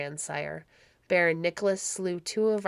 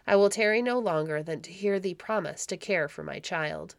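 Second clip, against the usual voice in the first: plain reading voice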